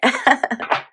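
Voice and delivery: high giggle she can't hold back. Real, not acted. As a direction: giggle through it. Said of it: "I have to stop laughing".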